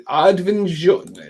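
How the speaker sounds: Arvinsjaur drawn out slowly in a put-on Swedish accent. Shown as a demonstration. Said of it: "Trying to put the accent on".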